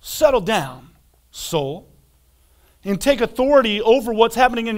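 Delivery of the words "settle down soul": calm, softening "settle down, soul"; soothing soul. Pretend. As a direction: hush your own soul gently. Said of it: "tell your soul".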